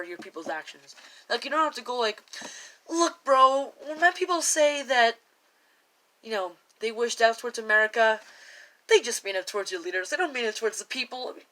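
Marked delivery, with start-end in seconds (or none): 2.9-11.3 hammed-up casual excuse, higher and louder; mocking impression of a politician making excuses; exaggerated mock excuse. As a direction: overplay the leader brushing it off to a bro. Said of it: "I was just exaggerating".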